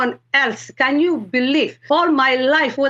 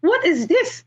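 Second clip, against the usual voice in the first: higher-pitched, mildly astonished question; retold bafflement, pitched well up; bewildered; 10 semitones higher than usual. Telling how everything she touched became a cross, bewildered.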